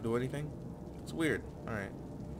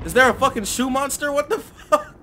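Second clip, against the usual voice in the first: high, loud laughing shock; cracking up in shock at the monster; laughing disbelief; 11 semitones higher, 9 dB louder than usual. Real, not acted. Gamer laughing at a shoe monster, shocked.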